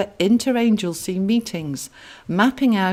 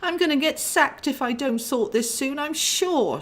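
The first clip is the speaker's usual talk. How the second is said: higher, anxious fretting; worried; 8 semitones higher than usual. Acted. Clarabelle frets to her brother about being sacked, higher. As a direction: fret aloud, near panic. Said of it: "she worried on".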